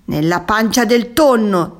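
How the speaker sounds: loud, high, open-mouthed pounce; gaping threat. A narrator's reading. Tuna about to swallow the squid, loudly triumphant. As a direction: throw it out loud with mouth wide. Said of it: "disse il tonno spalancando la bocca".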